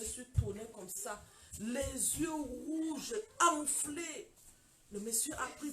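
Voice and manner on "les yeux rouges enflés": very slow, each word stretched out; slow dramatic description. Retold or imitated. Woman describing her tear-swollen eyes to listeners, slow and dramatic. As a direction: draw the words out for effect.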